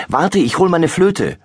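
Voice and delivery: put-on excited girl calling out. A narrator's reading. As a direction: call after mum as you run off. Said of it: "ruft sie".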